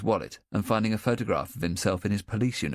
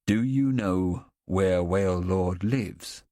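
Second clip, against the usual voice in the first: slow, level, carefully calm; forced calm; much slower than usual. A narrator's reading. Policeman asking the boy, slow and deliberately calm. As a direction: keep it steady, hide the worry. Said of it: "tries to keep his voice completely calm".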